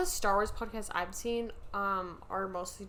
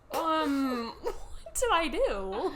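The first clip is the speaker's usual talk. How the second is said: drawn-out, slow mock confusion through laughs; mock bewilderment; 4 semitones higher, 3 dB louder, much slower than usual. Host re-enacting being laughed at by co-host, slow mock bewilderment. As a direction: stretch each word, laughing, playing baffled.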